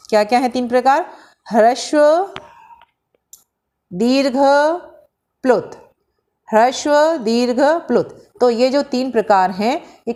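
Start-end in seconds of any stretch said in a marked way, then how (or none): 1.5-5.7 each term said slowly and distinctly, raised pitch; teacher spelling out ह्रस्व, दीर्घ, प्लुत carefully; careful classroom enunciation; clear demo pronunciation. Shown as a demonstration. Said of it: "मैं pronunciation करके बता रही हूँ". 6.5-8.2 each term said slowly and distinctly, raised pitch; teacher spelling out ह्रस्व, दीर्घ, प्लुत carefully; careful classroom enunciation; clear demo pronunciation. Shown as a demonstration. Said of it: "मैं pronunciation करके बता रही हूँ".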